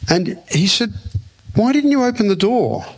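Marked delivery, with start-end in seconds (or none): 1.6-2.9 high-pitched, mild mock reproach; incredulous question. Friend challenges him over the angel at the door, mock reproachful. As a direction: ask as a challenge, pitched well up.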